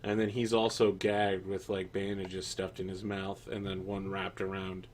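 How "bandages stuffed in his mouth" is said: ordinary talk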